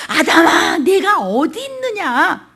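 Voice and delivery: loud and high, very slow call to Adam; God calling out. Acted. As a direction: call out slowly across a garden. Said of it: "하나님이 ... 너 어디 있느냐는 건".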